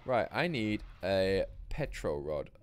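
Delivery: ordinary talk, nothing marked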